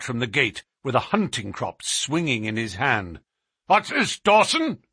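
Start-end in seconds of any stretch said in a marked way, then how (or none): 3.7-4.8 high, loud challenge; fierce master's sharp demand; angry cry. A narrator's reading. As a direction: bark it at a servant caught idling. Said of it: "he cried".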